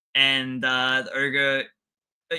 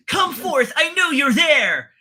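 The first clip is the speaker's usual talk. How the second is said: high, loud, slow dramatic mage shout; hammed-up anime mage; 13 semitones higher, 7 dB louder, slower than usual. Recapping an anime to a friend, a high, hammed-up mage shout. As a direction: overplay the wizard summoning his power. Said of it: "his voice acting's not that bad, I'm really playing it up".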